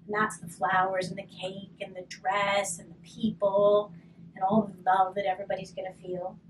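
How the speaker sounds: ordinary delivery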